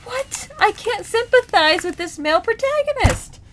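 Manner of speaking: very high, squeaky disbelief; incredulous outburst. Retold or imitated. Reader reacting to an unlikeable male protagonist, incredulous. Acting out her exasperated reaction.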